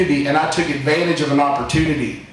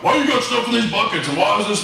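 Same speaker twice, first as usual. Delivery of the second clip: higher, mock suspicious father; suspicious dad; 5 semitones higher, faster than usual. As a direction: quiz him like a puzzled dad. Dad questioning the buckets.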